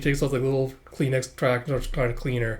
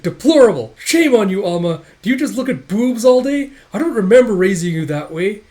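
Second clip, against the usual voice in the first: loud, high mock-motherly rebuke; scolding; 8 semitones higher, 9 dB louder than usual. Woman scolding the boy she claims she raised, indignant. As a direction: shame him like an indignant parent.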